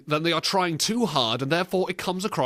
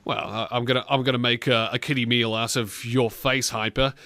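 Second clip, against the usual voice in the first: ordinary talk, nothing marked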